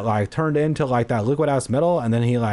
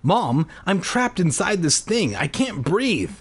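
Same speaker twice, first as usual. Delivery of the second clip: high-pitched, panicky explanation; distressed pleading; 7 semitones higher than usual. A narrator's reading. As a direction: plead with mom for help. Trapped boy pleads with mother.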